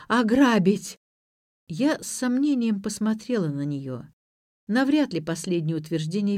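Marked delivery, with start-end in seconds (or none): 0.1-1.0 mildly tearful, louder cry; tearful outcry. A narrator's reading. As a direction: burst out plaintively, as if through tears. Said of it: "захлёбывалась сейчас слезами Ирина".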